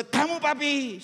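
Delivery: high-pitched imitation of a concerned wife; wife's insistent question. Wife's question repeated, acted higher. (tiruan istri bertanya dengan nada tinggi)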